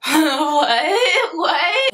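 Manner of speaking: high, laughing disbelief; nervous laughter. Retold or imitated. Girl so taken aback by his request, laughing nervously. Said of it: "nervous laughter".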